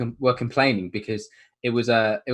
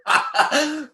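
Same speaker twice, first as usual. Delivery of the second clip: high-pitched laughter breaking through the words; giggling so hard the words squeak out; cracking up mid-sentence; laughing hard; 9 semitones higher, slower than usual. Real, not acted. Cracking up about the kid.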